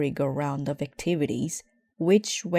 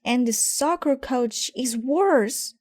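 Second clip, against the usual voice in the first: put-on high, raised little-girl voice; yelled complaint; 8 semitones higher, 3 dB louder than usual. Sister yelling from the back seat, high and raised. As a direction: yell it up as the young sister. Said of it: "yelled Sister".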